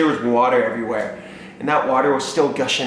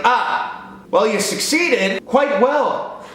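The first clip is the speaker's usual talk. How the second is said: dad taking charge of the broken pipe; pompous dad; 10 semitones higher than usual. Retold or imitated. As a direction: play a proud handyman hero. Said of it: "He's like".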